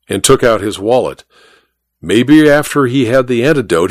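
usual speaking voice

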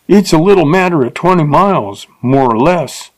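a coarse stranger brushing her off; rough man. A narrator's reading. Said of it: "said the man".

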